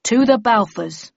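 solemn toast. Acted. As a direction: propose a toast to the lost. Leader proposes toast to the dead.

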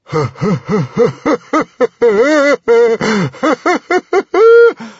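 high, loud hysterical laughter; roaring laugh. A narrator's reading. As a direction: burst into a long, roaring cackle. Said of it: "the librarian laughed".

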